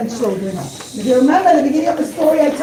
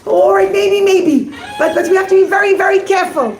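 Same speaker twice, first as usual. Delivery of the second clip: higher, half-laughing, cautious giving in; hesitant rabbi; 6 semitones higher than usual. Acted. Rabbi tells the captain to be careful, hesitant. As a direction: agree reluctantly, with a warning. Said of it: "he said".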